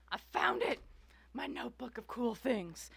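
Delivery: high delighted cry; triumphant squeal of discovery; excited find. Real, not acted. Finds the lost notebook.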